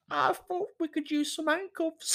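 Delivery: high, squeaky naive suggestion; squeaky wife. Acted. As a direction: deliver the punchline in a squeak.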